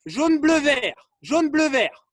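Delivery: called-out command. Real, not acted. Coach sends players to coloured cones, loud call. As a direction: shout the sequence to the group.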